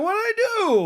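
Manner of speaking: very high, baffled whine; mock innocent. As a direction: play dumb, voice way up. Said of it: "I'm like".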